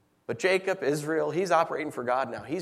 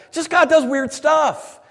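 fervent preaching; 9 semitones higher, 9 dB louder than usual. Real, not acted. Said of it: "preaching at full force".

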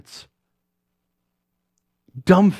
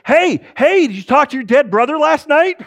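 high, loud, excited questioning; keen, loud greeting that rushes into a question; eager Two-Eared Larry; 11 semitones higher, 3 dB louder than usual. Acted. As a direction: call out brightly with raised pitch. Eager man accosting Dan.

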